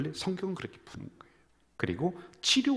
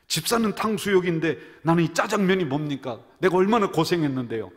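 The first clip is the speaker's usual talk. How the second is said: louder, higher aggrieved protest; elder protesting to Jesus about his lunch; indignant complaint; 5 semitones higher, 8 dB louder than usual. Retold or imitated. Elder in heaven complaining to Jesus, louder and aggrieved. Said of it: "화가 났습니다".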